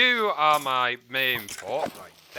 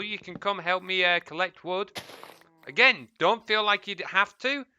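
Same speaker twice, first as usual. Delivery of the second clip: ordinary talk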